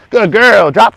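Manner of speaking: high, loud, gushing dog praise; animated praise. Real, not acted. Trainer praising dog on the mat, high and excited. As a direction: cheer the dog on like she just won. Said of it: "You're so animated".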